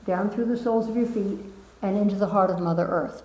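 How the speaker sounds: guiding a seated group through the flow; guided meditation. Acted. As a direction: lead them gently through the energy. Leads energy meditation.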